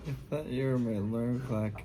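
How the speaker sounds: usual speaking voice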